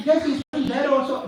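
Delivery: high-pitched, exasperated reply; insistent son. Acted. Prahlad answering his father's question, insistent. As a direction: answer as if asked once too often.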